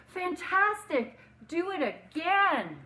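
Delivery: slow, high, loud cheering shout. Acted. Princess egging the tired dragon on, slow high shout. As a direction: cheer him on, dragging it out. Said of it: "Elizabeth shouted".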